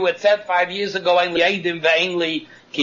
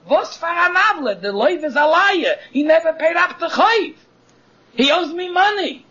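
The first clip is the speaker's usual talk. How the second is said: much higher, protesting lender; the lender's pitched-up outrage; indignant lender; 8 semitones higher than usual. Acted. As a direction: object higher, as the wronged lender. Lender indignantly defends himself.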